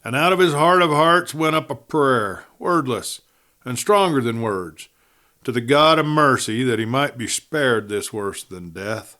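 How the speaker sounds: ordinary delivery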